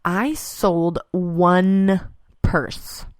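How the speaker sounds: drawn-out, dropping to a breath on purse; slow deadpan. Real, not acted. Telling listeners about a bad trade show, slow and deadpan. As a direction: let it land slowly, then let purse fall away. Retelling a disappointing sale.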